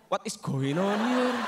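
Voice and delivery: slow English from a possessed foreigner. Pretend. Possessed Westerner in Java, slow bewildered English. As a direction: speak slow, puzzled English. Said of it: "ngomong bahasa Jawa bule".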